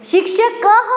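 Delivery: शिक्षकः ending in a clear breathy "ह" after the final अ; visarga clearly sounded. Shown as a demonstration. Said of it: "ऐसे उच्चारण करना सीखेंगे". (शिक्षकः का विसर्ग साफ़ "ह" की तरह बोलें)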